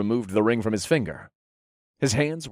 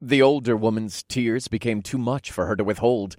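usual speaking voice

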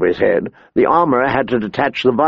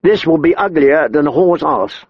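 ordinary delivery, nothing marked

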